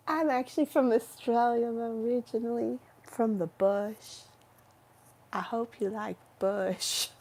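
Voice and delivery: a hammed-up Valley Girl introducing herself. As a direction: play a ditzy Valley Girl on a dating ad. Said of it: "I kinda like the valley accent".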